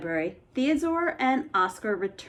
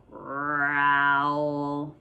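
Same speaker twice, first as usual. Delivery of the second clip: low monster growl; 7 semitones lower than usual. Acted. Angry monster growling at the trap, low. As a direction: lower drawn-out growl.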